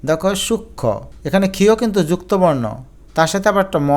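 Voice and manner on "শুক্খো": সূক্ষ্ম as "shuk-kho", no m; silent m. Shown as a demonstration. Teacher showing silent m, সূক্ষ্ম as "shuk-kho". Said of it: "ম ফলা উচ্চারিত হয় না".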